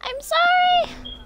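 very high, slow, stretched sorry; squeaky mock apology. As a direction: squeak it out like a cartoon. Apologising to game NPC.

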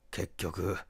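ordinary delivery, nothing marked

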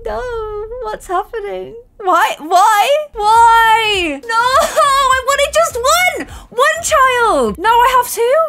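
high, slow mock-despairing wails; high whiny despair over twins. Real, not acted. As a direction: wail at the surprise twins. Surprise twins born.

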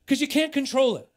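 loud and high, driving it home; aggressive preaching. Real, not acted. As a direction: preach it hard at full force. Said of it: "if this sounds aggressive".

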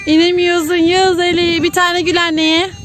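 slow, high singsong; playful teasing. Pretend. Parent coaxing a spinning child to smile, playful singsong teasing. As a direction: stretch it out to coax a giggle.

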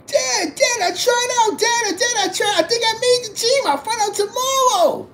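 very high, squeaky girl impression; excited daughter. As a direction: imitate a thrilled daughter on the phone. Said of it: "She called me".

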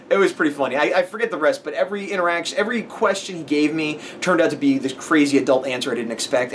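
normal talking voice

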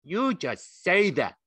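high, loud, slow rebuke; sharp. A narrator's reading. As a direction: cut him off as a scolding aunt. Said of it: "she said sharply".

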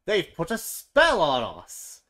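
loud, slow accusing cry. A narrator's reading. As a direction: hurl the blame at the warthogs. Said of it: "cried the other animals".

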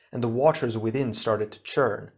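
ordinary delivery, nothing marked

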